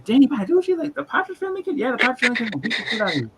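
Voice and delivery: high, squeaky incredulous voice; mock disbelief. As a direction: play a shocked fan who can't believe it. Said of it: "you'd be like".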